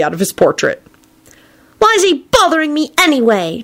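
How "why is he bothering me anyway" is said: put-on high, whiny boy voice; sulking. A narrator's reading. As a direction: complain in a childish sulk. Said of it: "turned to Dumbledore and sulked".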